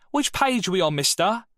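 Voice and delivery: mock the class like a smug fifteen-year-old; cheeky schoolboy.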